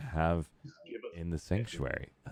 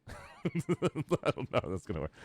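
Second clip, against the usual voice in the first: higher, slower, talking through a laugh; 5 semitones higher, 4 dB quieter, slower than usual. Real, not acted. Streamer puzzled by laser tag idea, laughing. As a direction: laugh your way into the words.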